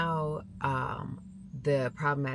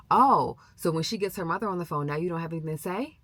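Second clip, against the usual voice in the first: imitating a taunting coach, raised and loud at first; mock confrontational; 4 semitones higher, 6 dB louder than usual. Coach taunting a player with her mother on the phone, mock confrontational. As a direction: pounce on it with a high, loud "oh".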